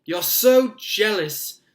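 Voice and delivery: high, loud, drawn-out accusation; slow high-pitched cry; piping up. A narrator's reading. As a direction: pipe up indignantly in a small boy's voice. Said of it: "piped up Colin".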